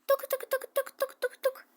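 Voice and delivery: high mouth-made knocking; quick high playful knocking; knock knock sound. Pretend. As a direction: tap out a knock with your voice. Knocking at the door.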